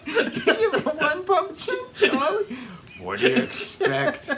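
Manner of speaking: high mocking squeal with laughter; shrill taunting falsetto. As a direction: screech the taunt in a cartoon voice.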